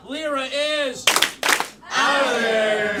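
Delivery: booming, drawn-out elimination call; host eliminates a player to applause; loud slow call. Shown as a demonstration. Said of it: "do that with us".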